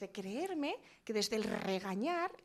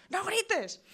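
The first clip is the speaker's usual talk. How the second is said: "¡No grites!" shouted much higher and louder; shouted order; 10 semitones higher, 8 dB louder than usual. Shown as a demonstration. Parent yelling at a child not to shout, ironic loud shout. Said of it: "normalmente decimos".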